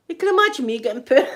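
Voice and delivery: talking through real laughter; laughing. Real, not acted. Woman picturing herself getting put away, laughing.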